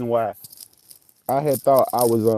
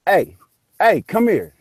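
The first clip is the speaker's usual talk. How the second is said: loud, high "hey" to summon someone; raised-voice retold summons; imitated calling out; 7 semitones higher, 7 dB louder, slower than usual. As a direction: call him over from the house. Retold man calling him over.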